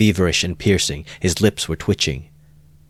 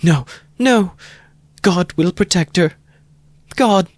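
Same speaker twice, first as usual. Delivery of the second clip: put-on high, louder pleading young woman; cling to the words, frantic; beside herself; 13 semitones higher, 4 dB louder than usual. A narrator's reading. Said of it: "she repeated beside herself".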